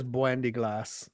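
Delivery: low, slow, soft mock British accent; imitated British accent. Quoting the comedian's favorite phrase, imitated British accent. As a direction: quote it in a slow Cockney imitation. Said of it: "I like when he says".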